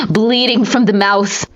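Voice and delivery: ordinary delivery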